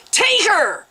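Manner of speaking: high, loud barked order; yelling. A narrator's reading. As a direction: yell at the attendants. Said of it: "Mens yelled".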